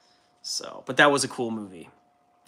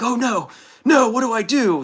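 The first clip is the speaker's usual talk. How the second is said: higher, louder mock panicky whine; 10 semitones higher, 7 dB louder than usual. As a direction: ham up a dad in a panic. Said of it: "He's like".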